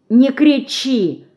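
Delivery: a pained, drawn-out plea to stop shouting; wincing. A narrator's reading. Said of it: "поморщилась Динка". (поморщившись, просит не кричать)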